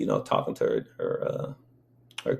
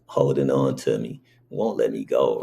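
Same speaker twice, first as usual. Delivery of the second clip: usual speaking voice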